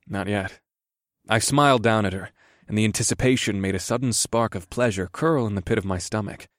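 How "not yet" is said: breathy mutter. A narrator's reading. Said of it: "Sid muttered in a breathy voice".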